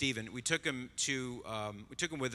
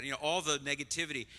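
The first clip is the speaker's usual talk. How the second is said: usual speaking voice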